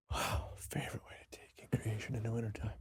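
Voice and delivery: very soft, whispered musing; thinking aloud. Real, not acted. Guest pondering the host's question to himself, barely audible. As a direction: repeat the question under your breath.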